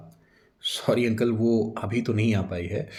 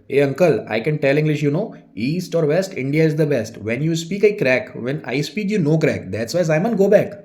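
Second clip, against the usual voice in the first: loud, higher-pitched broken-English tirade; angry; 5 semitones higher, 5 dB louder, much slower than usual. Acted. Vijay shows off his English to the assistant, angrily. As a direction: rattle off English at him, loud and indignant. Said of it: "विजय को गुस्सा आ जाता है".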